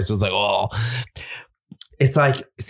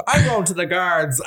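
stern threat; 8 semitones higher than usual. Retold or imitated. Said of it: "and he goes".

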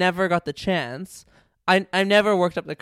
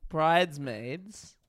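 ordinary delivery

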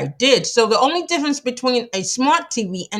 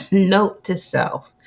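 ordinary talk, nothing marked

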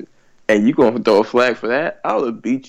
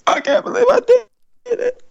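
very high, choked-up disbelief; overwhelmed player. Pretend. Player after winning, high choked disbelief. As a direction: squeak it out like you're about to cry. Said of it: "I'm just gonna be".

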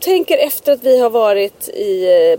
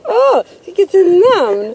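mock admiring; 7 semitones higher, much slower than usual. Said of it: "Jag bara".

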